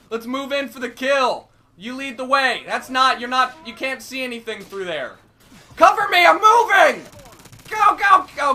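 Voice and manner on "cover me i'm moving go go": loud urgent callout; shouted callout. Real, not acted. Gamer calling to teammates mid-fight, urgent shout. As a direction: shout to the team to cover.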